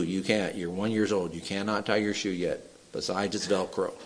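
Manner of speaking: low, soft matter-of-fact rebuttal; parent correcting toddler. Acted. Parent telling a one-year-old no, low and quiet. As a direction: quietly put the child straight, dropping low. Parent answering stubborn child.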